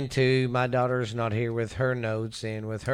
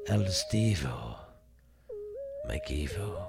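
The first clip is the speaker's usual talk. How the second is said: sexy man voice; 3 semitones lower, 4 dB quieter, slower than usual. Pretend. Continues the sexy name voice.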